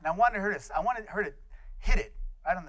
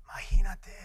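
whispered grandfather; 2 semitones higher, 4 dB louder than usual. Retold or imitated. Grandfather whispering in awe to grandson.

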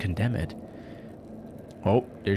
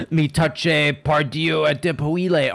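loud, high hammed-up French; pitched up, over-the-top recital; mock-French outburst; 7 semitones higher, 5 dB louder, slower than usual. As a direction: declaim the French with mock drama. Mock-French reading of found book.

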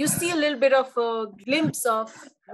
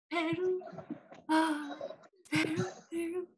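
soft, high, airy song hook; soft whisper; 6 semitones higher, 9 dB quieter than usual. Retold or imitated. Reviewer mimicking the song's hook, soft whisper. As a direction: lightly whisper it like a lullaby. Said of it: "it was like a soft whisper".

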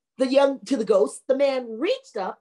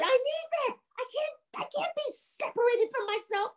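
very high, strained wail falling softer; distraught ghost; 13 semitones higher, 7 dB quieter than usual. Acted. Ghost sees its ribs thrown in the pond, high distraught wail. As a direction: wail in mock despair.

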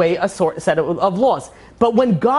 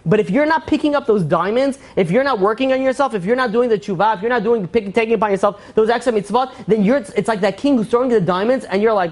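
ordinary talk, nothing marked

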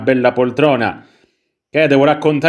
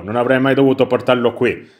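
normal talking voice

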